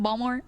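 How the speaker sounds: "Balmore" with the t dropped, rising; local dropped t. Shown as a demonstration. Streamer repeating the Baltimore local "Balmore". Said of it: "They say Balmore".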